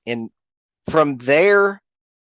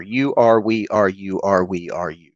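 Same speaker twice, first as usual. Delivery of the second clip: repeated mantra; 4 semitones lower than usual. Retold or imitated. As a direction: recite it like a voice circling in the head. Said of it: "Just these words over and over".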